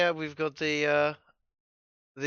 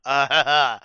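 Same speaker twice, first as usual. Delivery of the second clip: flustered embarrassed giggles; louder giggling outburst; giggly laughing; 8 dB louder than usual. Real, not acted. Flustered after saying daddy crab.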